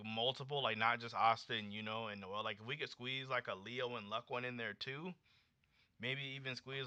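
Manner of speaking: usual speaking voice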